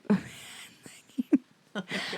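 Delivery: breathless whisper through laughter; fast, high whisper broken by giggles. Real, not acted. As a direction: whisper while trying not to laugh. Laughing at farting in surgery.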